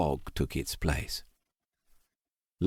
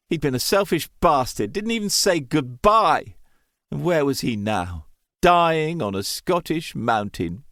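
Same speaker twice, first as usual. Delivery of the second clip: anguished self-loathing; 7 semitones higher than usual. A narrator's reading. Stranded son curses his own foolishness, anguished. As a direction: tear into yourself in despair.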